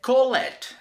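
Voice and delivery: put-on "call it" said high and loud with a heavy Spanish accent; imitating a friend's bad Spanish-accented "call it"; mock bad accent. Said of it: "in a very bad accent".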